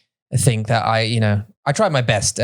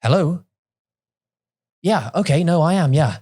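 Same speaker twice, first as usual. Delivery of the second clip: advert phone voice; 3 semitones higher than usual. Retold or imitated. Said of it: "I've done a couple of adverts where I go".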